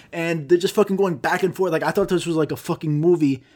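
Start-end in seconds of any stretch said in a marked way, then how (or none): none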